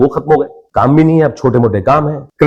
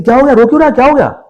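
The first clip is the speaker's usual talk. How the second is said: worried mother; 10 semitones higher than usual. Retold or imitated. Mother asks why he is crying.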